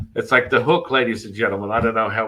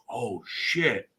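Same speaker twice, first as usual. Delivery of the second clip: understated retold oath, softer; subdued mock dismay; 2 semitones higher, 5 dB quieter than usual. Retelling the man realizing it, understated mock dismay. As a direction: let the realization drop out softly. Same reaction retold again, much lower.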